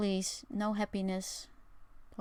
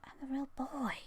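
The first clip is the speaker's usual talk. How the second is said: put-on higher, hushed puppet-boy voice; Pinocchio voice; 6 semitones higher, 7 dB quieter than usual. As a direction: play a wooden boy coming alive. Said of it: "I am the truest Pinocchio".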